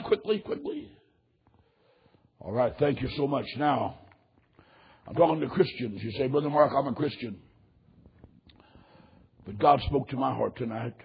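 low, soft pastoral voice; hushed invitation during prayer; altar call. Real, not acted. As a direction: lead them quietly, pausing between phrases. Leads altar call, heads bowed.

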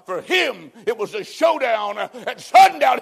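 loud and slow, driving the line home; preaching shout. Real, not acted. Preacher to his congregation, a slow loud preaching shout. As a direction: hammer each word out to the hall.

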